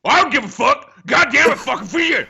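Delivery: yelled rant at four a.m; angry outburst. Retold or imitated. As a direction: scream it out in a rage. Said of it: "I'd hear".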